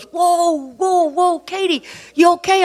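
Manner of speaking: high, drawn-out mock alarm; startled concern. Doctor walking in on crying patient's wife, startled. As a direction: halt someone with high, concerned whoas. Doctor meets upset woman, acted.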